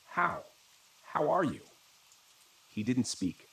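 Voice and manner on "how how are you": high, cracking voice of disbelief. A narrator's reading. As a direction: let the voice crack on the question. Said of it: "My voice cracked".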